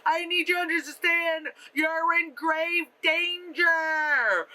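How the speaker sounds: high, stretched, overacted warning; mock yell. Warning someone through a window, hammed-up mock yell. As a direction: ham it up slowly toward the last word. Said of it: "she's, like, yelling through the, the window".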